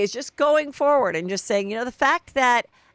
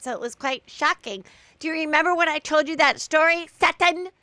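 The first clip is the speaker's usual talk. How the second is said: high-pitched impression telling a racy anecdote; Jennifer Tilly; 6 semitones higher than usual. Telling Sutton about the Broadway bathtub play, high impression. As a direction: keep the voice perched high while storytelling. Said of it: "Jennifer says".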